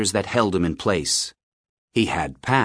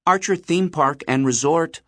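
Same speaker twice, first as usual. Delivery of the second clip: put-on higher-pitched boy on the phone; boy answering phone; 8 semitones higher than usual. A narrator's reading.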